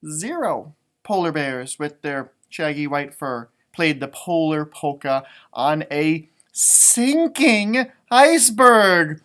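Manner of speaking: rhythmic read-aloud refrain, drawn out; sing-song story reading. A narrator's reading. Parent reading the refrain to children, sing-song. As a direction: read it like a picture book chant.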